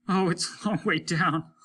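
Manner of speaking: understated dread; a frightened crewman drifting away; a hint of fear. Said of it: "said a voice".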